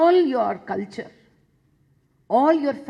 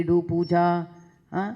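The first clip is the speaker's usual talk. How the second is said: normal talking voice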